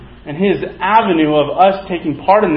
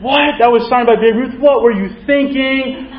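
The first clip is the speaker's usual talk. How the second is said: incredulous outburst; 6 semitones higher than usual. Acted. Friends to the kid who lost the ball, incredulous. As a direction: stretch the last question out in high, appalled astonishment.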